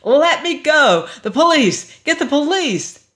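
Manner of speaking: high, loud panicked shouts; frantic yelling. A narrator's reading. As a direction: yell for help while fighting free. Struggling free, screaming for police.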